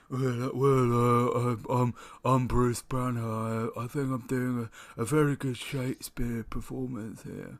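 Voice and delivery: mock earnest actor voice; hammy self-serious actor; Edward Norton impression. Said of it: "Edward Norton thought he was doing that".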